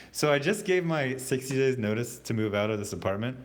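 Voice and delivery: ordinary talk, nothing marked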